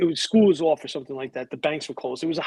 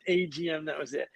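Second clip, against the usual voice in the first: normal talking voice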